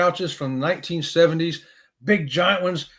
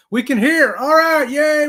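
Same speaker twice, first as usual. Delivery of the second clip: loud, high relieved cheer; high, loud and delighted; excited cheer; 10 semitones higher, 8 dB louder, slower than usual. Real, not acted. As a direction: cheer that it finally works. Relief that sound works.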